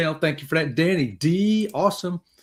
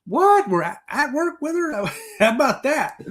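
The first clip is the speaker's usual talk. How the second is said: surprised; 9 semitones higher than usual. Retold or imitated. Caught sight of a viewer on camera, hammed-up surprise. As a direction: act like you just spotted a friend on screen. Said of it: "I'm like".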